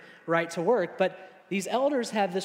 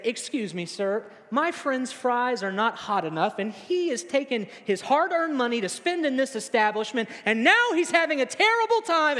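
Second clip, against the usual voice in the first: mock outrage building higher and louder; hammed-up righteous friend, climbing to a loud peak; indignant complaint; 5 semitones higher, 4 dB louder than usual. Friend complains to the server on your behalf, mock-indignant.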